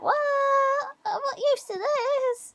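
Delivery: lightly voiced little bird; a hint of a pet's voice; budgie's inner voice. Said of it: "camera like".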